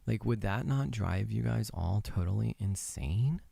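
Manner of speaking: low, quiet, flat-toned monotone. Retold or imitated. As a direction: drone on like a bored script reader. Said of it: "I talked like this the whole entire time".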